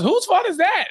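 high-pitched acted accusation; accusing outburst. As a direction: throw the blame in a high, indignant voice. Acts mom flinging blame at Harvey.

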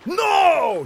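high, drawn-out villain's cry; hammed-up high shout; acted shout. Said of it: "shouted Laszlo".

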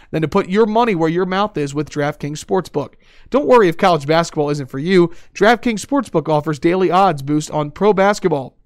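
upbeat sponsor ad read. Acted. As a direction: sell it like a radio spot. Reads a sponsor ad.

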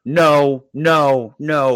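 put-on whiny kid refusal. Crying four-year-old refusing.

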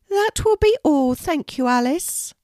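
a kindly Queen welcoming a guest, pitched well up. Acted. The Queen inviting a shy girl to tea, high regal voice.

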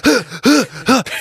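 loud gasp. Pretend. Mock outraged neighbour yelling.

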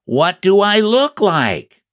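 high, slow call from above. Acted. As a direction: stretch it out to reach the ground. Pooh calling down from the sky.

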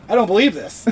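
lightly voiced host in disbelief; host can't believe it, half-laughing; a touch of amazed host; mock disbelief. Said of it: "he goes".

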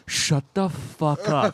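drawn-out, slow disbelief; stunned disbelief. Retold or imitated. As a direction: stretch each word out, retelling your amazement. Retold stunned reaction to the voice.

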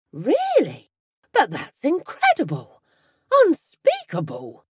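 loud, high overdone outrage; feigned shock. A narrator's reading. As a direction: fake astonishment to hide relief. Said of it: "trying not to show the relief".

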